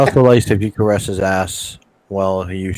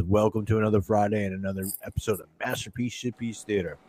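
normal talking voice

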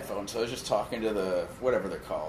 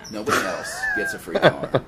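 much higher, louder playful shout; joking shout; 4 dB louder than usual. Real, not acted. Begging fans on the podcast, playful high shout. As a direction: yell it as a greedy joke. Joking plug, claims car alone.

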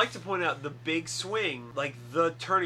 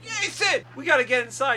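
very high, loud mock-frantic shout; retold urgent plea; 11 semitones higher, 8 dB louder than usual. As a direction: imitate someone frantically calling a friend. Acting out Randy's urgent plea.

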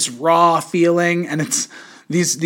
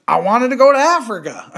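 high-pitched comedian impression; rough stab at a comedian's voice, pitched up; Dave Chappelle; 6 semitones higher, 3 dB louder than usual. Podcaster quoting the comedian's Africa story to a co-host, amateur impression. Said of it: "is that a good Dave Chappelle".

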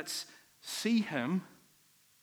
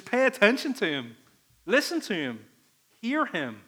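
emphatic command; 5 semitones higher, 5 dB louder than usual. Acted. Preacher voices God's command.

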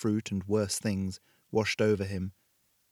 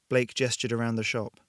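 ordinary delivery, nothing marked

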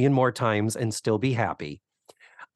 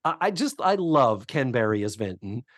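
ordinary talk, nothing marked

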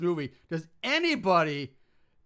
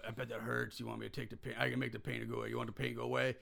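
much softer, lower, coaxing djinn voice; mock soothing temptation; 8 semitones lower, 12 dB quieter, faster than usual. Djinn tempting the man in pain, soft and low. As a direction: croon the offer low and soft. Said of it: "he's like".

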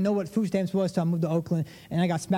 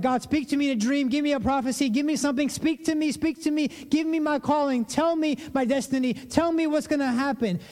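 high-pitched, insistent string of requests; eager pleading; 6 semitones higher than usual. Acted. Believer wanting to hear God, eager pleading.

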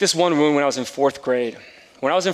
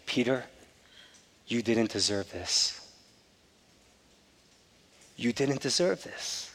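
softer, slow, gentle repeating; tender reassurance; 9 dB quieter, slower than usual. Retold or imitated. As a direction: comfort the hurt boy softly and slowly. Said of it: "he said these very words to me".

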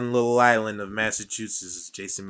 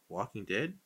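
normal talking voice

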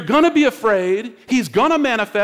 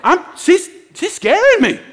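higher and louder, slow, with a nervous laugh; pleading frightened insistence; scared insisting; 7 semitones higher, 4 dB louder, slower than usual. Retold or imitated. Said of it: "I'm like".

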